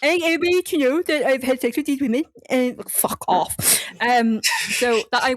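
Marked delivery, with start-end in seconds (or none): 0.0-3.6 higher, fast put-on killer voice; mock killer. Host voicing the killer to friends, defensive put-on voice. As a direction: spit it out like a defensive creep. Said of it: "killer bit".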